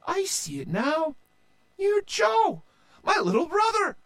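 very high, amazed voice; light up with recognition. A narrator's reading. Sister realizing it's her little brother, very high. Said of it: "she said".

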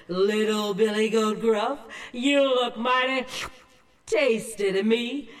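a hungry troll licking his lips over the goat. Acted.

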